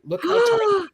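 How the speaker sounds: very high delighted squeal. Real, not acted. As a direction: squeal over the tiny cards. Showing tiny vintage cards.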